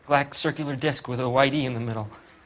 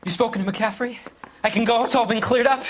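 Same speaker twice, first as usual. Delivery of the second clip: anxious; 9 semitones higher, 4 dB louder than usual. Acted. Anxious suspect asks to leave.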